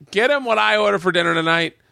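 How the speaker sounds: retold yell to the kitchen; mock yell. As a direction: imitate calling an order to the back. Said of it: "he yells in the back".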